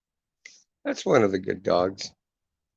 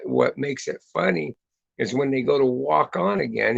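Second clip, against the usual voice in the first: ordinary talk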